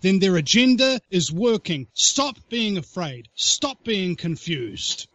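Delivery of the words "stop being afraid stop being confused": slow calming command. Real, not acted. Urging listeners, slow.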